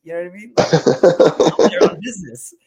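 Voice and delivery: ordinary talk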